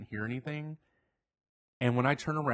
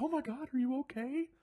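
much higher, concerned passer-by voice; worried bystander. Retold or imitated. Bystander runs up to the walker, alarmed and much higher. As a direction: ask like an alarmed stranger.

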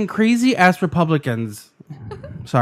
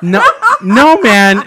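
normal talking voice

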